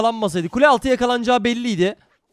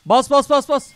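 loud, higher repeated command; urgent urging; 5 semitones higher, 5 dB louder, slower than usual. Real, not acted. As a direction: push a teammate to go in now. Urging teammate to engage. (heyecanla bağırarak teşvik)

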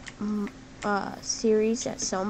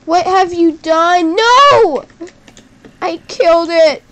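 much louder, higher, slower cry of horror; anguished shout; 7 semitones higher, 15 dB louder than usual. Real, not acted. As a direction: scream in dismay at the dead pet. Accidentally killed the fox.

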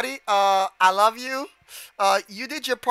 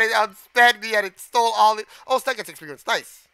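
ordinary talk, nothing marked

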